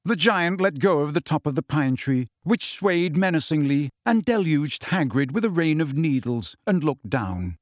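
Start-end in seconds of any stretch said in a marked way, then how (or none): none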